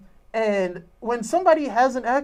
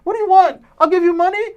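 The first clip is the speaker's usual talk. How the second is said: high, loud mock panic; retold pleading; 9 semitones higher, 6 dB louder than usual. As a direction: beg for your life, hammed-up. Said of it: "He's like".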